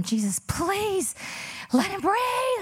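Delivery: high, drawn-out desperate scream; anguished scream. Retold or imitated. Mother praying for her son to breathe, anguished scream. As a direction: scream a prayer, stretching the words out high. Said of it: "I'm just screaming".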